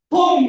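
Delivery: high loud blast of bom!; explosion bom. Acted. Preacher miming pent-up anger exploding, shouting.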